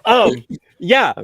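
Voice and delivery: high, loud, laughing objection. Real, not acted. Host shutting down a co-host's crude joke, laughing.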